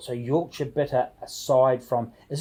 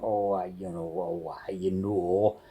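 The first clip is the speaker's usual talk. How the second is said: lower, soft imitation of a Yorkshire local in the pub; mock Yorkshire pub accent; 6 semitones lower, 5 dB quieter than usual. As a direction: lightly put on a Yorkshire pub regular's accent. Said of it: "you've got the accent going".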